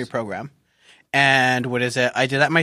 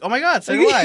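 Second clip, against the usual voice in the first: excited squeal; 9 semitones higher, 4 dB louder than usual. Retold or imitated. Retelling excited app chat.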